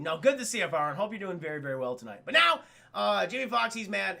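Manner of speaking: normal talking voice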